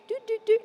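high sing-song doo doo doo; a thought flitting past, sung high; fleeting thought sound. Shown as a demonstration. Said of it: "And when I say fleeting".

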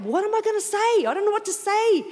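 high, wailing mock fretting; exaggerated high-pitched worry; mock panic. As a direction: act out flustered helplessness. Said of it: "you can be like that".

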